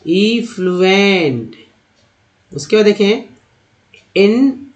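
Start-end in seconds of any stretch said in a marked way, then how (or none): 0.1-1.6 "इफ्लुएंट" slowly as ee-flu-ent; slow syllables in "इफ्लुएंट"; model pronunciation. Shown as a demonstration. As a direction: stretch "इफ्लुएंट" out for learners. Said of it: "next है".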